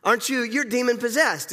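playground insult. Retold or imitated. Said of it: "much like children on a playground".

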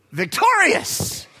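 high, slow triumphant cry; mock triumph. Preacher crows over the dead wasps in mock triumph.

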